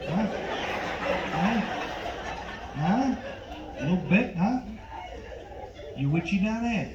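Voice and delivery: lightly voiced De Niro, pushy and amused; a hint of De Niro needling the customer; mock tough-guy salesman, chuckling; De Niro impression. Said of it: "my impression of Robert De Niro".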